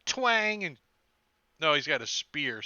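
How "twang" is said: high, loud twang of a crossbow string; high voiced crossbow twang; mouth twang. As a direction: pluck the sound out like a bowstring. Crossbow sound effect.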